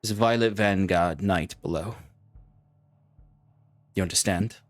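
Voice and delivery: brief the soldier on today's attack. Acted. Commander briefing the recruit before battle, measured.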